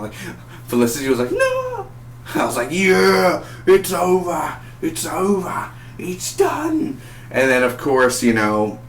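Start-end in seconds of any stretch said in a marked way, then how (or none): 1.3-1.9 very high mock wail; Felicity. 2.7-7.0 high, gleeful cheer; mock celebration. Mock celebration of their deaths.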